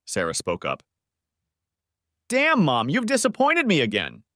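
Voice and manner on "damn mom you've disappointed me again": high, exasperated daughter; Sarah's voice. Acted.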